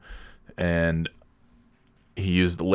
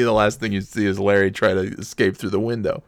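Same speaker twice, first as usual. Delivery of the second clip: ordinary talk, nothing marked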